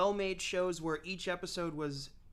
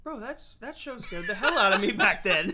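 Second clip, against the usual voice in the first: laughing; 3 semitones higher, 8 dB louder than usual. Real, not acted. Laughing while recalling scary show.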